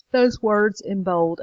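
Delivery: slow, drawn-out words through a real yawn. Real, not acted. Tired teacher explaining the bold words to the class, yawning. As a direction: stretch the phrase with a yawn. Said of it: "Hearing me yawn".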